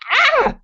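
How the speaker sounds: a sudden startled shriek at the spider; scream. Acted. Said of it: "Em screamed".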